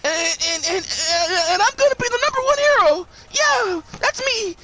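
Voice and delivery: high, stammering, overexcited mock voice; mock an eager underdog boasting, way up high; giddy hero-wannabe impression. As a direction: stammer proudly in a high-pitched, teary-eager voice. Acting out excited Deku boasting of his quirk.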